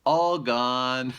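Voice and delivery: put-on high, loud little baby voice; baby bear voice. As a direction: chirp it like a happy toddler.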